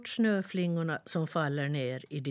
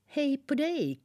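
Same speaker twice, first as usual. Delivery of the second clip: much higher bright twittering greeting; chirping bird; 10 semitones higher, 3 dB louder than usual. Acted. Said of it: "kvittrade den".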